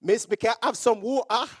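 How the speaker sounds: clumsy attempt at delicate English with Jamaican accent; trying to fit in. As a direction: imitate dainty English through a Jamaican accent. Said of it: "I wanted to fit in".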